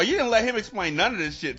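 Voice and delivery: normal talking voice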